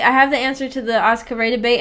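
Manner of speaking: ordinary talk, nothing marked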